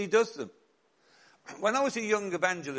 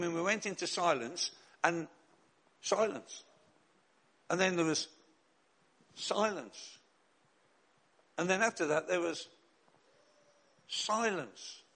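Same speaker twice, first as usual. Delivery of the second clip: long pauses, each silence softer; dramatic pauses; 4 dB quieter than usual. Shown as a demonstration. Telling the congregation about the silent service, pausing dramatically. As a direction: let silence hang before each word. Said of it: "silence built by pauses".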